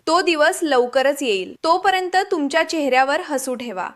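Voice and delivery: plain reading voice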